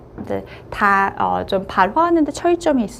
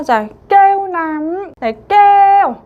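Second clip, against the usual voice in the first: 깨우남 called out higher and louder; emphatic model; 7 semitones higher, 6 dB louder, slower than usual. Shown as a demonstration. Teacher presents the second word, emphatic. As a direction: announce 깨우남 with a strong lift. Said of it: "teacher models a word".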